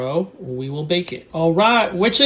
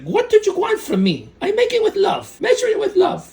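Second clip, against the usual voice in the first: much higher, far voice of his mom; Colombian mom; 4 dB louder than usual. Retold or imitated. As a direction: play a sweet Colombian mom. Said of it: "she's like".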